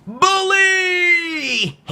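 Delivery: long, high-pitched shouted word; theatrical storybook shout; dramatic cry. A narrator's reading. Dramatic reveal of the bully.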